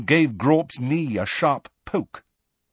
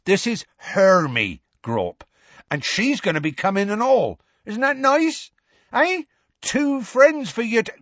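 higher, cheerful coaxing; Hagrid coaxing; 11 semitones higher than usual. A narrator's reading. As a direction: sell the idea to the giant. Hagrid presenting Hermy.

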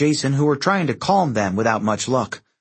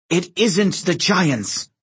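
seething rage; 7 semitones higher, much slower than usual. A narrator's reading. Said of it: "Reyna's eyes seethed with rage".

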